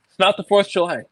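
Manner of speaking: high, loud mock protest to the kids; retold dad retort. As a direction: fire it back like a fed-up dad. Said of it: "I'm like".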